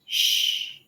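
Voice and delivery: long Shh like a teacher quieting a class; Shh, hushing a noisy class; teacher shushing. Retold or imitated. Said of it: "the teacher would walk around the room and say".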